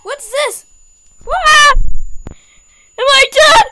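high alarmed yelling; shocked at falling; startled shout. Real, not acted.

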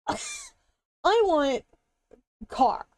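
put-on high little kid voice; childlike whine. Childlike wanting, high pitched.

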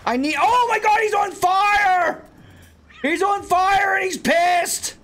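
high, loud, slow alarmed yelling; real panic at a burning creature; panicked shout. Real, not acted. Pal set on fire.

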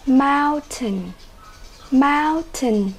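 "mountain" stressed on the first syllable; teacher modelling MOUN-tain. Shown as a demonstration. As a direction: hit MOUN in "mountain", let the ending fall. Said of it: "Nhấn âm tiết số một".

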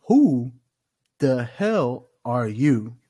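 loud, slow promo challenge, pausing after the first word; The Rock. Retold or imitated. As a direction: punch it out like a wrestling promo. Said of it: "Just like The Rock would do".

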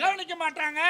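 sidekick's high, forceful echo; emphatic echo. Acted. Sidekick echoes the mother's line.

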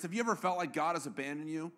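normal talking voice

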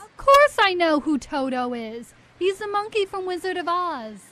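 loud, offended insistence; indignant outburst. Acted. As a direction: fire it back, insulted. Acted quarrel, defending herself.

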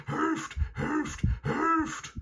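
very high howled calls for help; high, urgent dog wail; howling dog. A narrator's reading. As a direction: howl each word like a hound. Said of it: "heulte der Hund".

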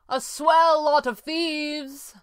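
high, drawn-out jeer; mocking call. A narrator's reading. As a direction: jeer down at them from above. Red panda mocks from above.

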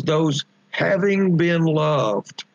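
"Those having been loved" drawn out slowly, leaning on "having"; slow emphasis. Real, not acted. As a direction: stretch "having" and "loved" at half pace. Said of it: "This is a real emphasis".

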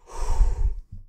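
long, breathy exhale; heavy sigh. Real, not acted. Gamer recovering after a panic, heavy sigh. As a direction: let out a big sigh of relief.

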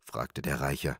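ordinary delivery

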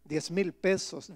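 normal talking voice

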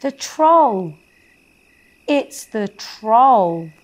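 high, loud scared cry; frightened cry. Acted. Fairy spotting the troll, high frightened cry. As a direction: gasp it out in fright, slowing down.

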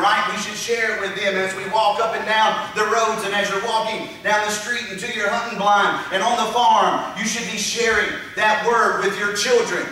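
normal talking voice

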